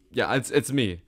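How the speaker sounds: ordinary talk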